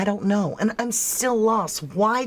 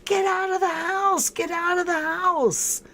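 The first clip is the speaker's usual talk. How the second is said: much higher, frantic mock yell; 12 semitones higher than usual. Viewer yelling at the heroine on screen: frantic high mock yell. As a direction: urge her to run, shouting high. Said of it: "you're screaming at the T V".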